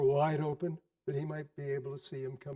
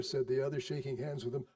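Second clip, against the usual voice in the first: plain reading voice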